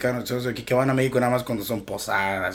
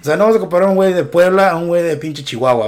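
louder, higher animated riff; excited; 5 semitones higher, 7 dB louder, faster than usual. Real, not acted. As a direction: get fired up about it. Animated riff on regional accents.

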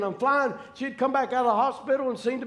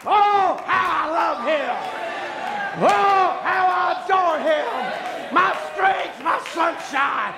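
loud, higher cries of praise; preacher crying out the hymn words to the church, loud; praise shouted out; 5 semitones higher, 4 dB louder, slower than usual. Real, not acted. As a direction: let it burst out of you. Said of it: "something within me screams out".

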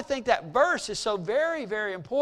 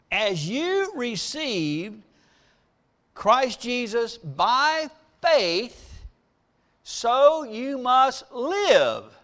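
slow dictation; much slower than usual. Shown as a demonstration. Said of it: "the summary statement is this".

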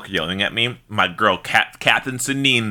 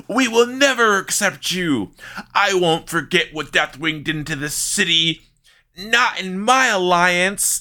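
high, hammed-up mob heckling; playing a jeering townsfolk crowd; mock angry mob; 6 semitones higher than usual. Angry mob jeering at the newcomer, mock-hostile high voice.

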